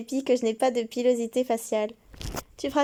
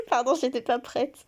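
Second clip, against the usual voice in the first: ordinary talk, nothing marked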